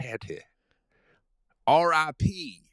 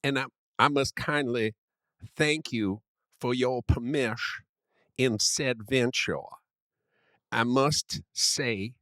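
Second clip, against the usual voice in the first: put-on Southern gentleman accent. Friend keeps up the Southern gentleman bit, mild Southern accent. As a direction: grandly declaim as a genteel Southern gentleman. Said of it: "I must use this voice".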